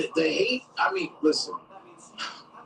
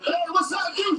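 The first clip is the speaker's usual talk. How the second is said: teasing inmate; 12 semitones higher than usual. Retold or imitated. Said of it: "be like".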